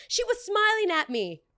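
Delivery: high, loud mock complaint; squealing mock tattletale; mocking whine. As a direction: mimic her whining to the manager. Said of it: "what are you gonna tell my manager".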